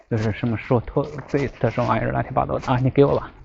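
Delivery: usual speaking voice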